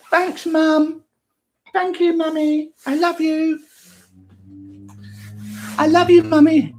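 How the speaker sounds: high, loud sugary voice; sweet little son. Pretend. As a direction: gush at mummy. Son thanks mother.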